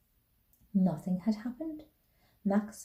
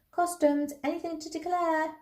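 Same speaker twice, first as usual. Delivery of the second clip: high, sustained official call; customs officers calling; 8 semitones higher than usual. A narrator's reading. Officials calling along the train to passengers, sing-out call. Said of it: "Voices saying".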